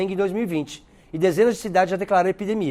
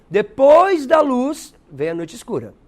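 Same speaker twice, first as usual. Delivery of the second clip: slow, higher, louder drawn-out explanation; slow explaining; 5 semitones higher, 5 dB louder, slower than usual. Shown as a demonstration. Teacher slowly unpacking the poem's lines for students. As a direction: stretch each word out slowly. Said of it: "Então, vê lá".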